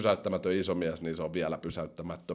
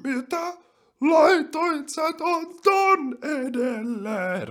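very high, drawn-out mock squawk; mock outraged commenter; 3 dB louder, much slower than usual. People yelling about a top ten list, mock outrage. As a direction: exaggerate the outrage, dragging the words out in falsetto. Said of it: "porukka vois huutaa".

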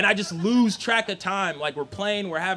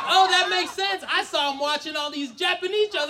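dawning realization; 8 semitones higher, 3 dB louder than usual. Acted. Mom's sudden realization.

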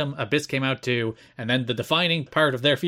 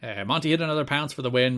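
ordinary delivery

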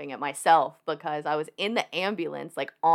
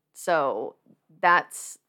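normal talking voice

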